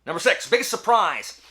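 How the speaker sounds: big, loud countdown call; loud announcement. Real, not acted. Vlogger announcing the next category, loud and high. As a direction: boom out the category like a show host.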